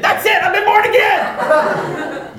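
very high, loud, drawn-out cry of discovery; hammed-up eureka yell; imitated exultant shout. Student realising she is born again, imitated in exultant shouting.

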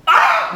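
sharp high yelp at sudden light; startled cry. Acted. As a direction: cry out as if the lights hit your eyes.

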